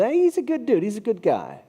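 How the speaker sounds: high, breezy and offhand; offhand casual praise. Retold or imitated. Preacher voicing how everyone casually praises a guy, high and breezy. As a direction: toss it off like a shrug. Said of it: "we would say that about most people".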